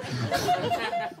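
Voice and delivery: very high, soft squeak of laughter; squeaky laugh. Real, not acted. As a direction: squeal quietly. (risita muy aguda y baja)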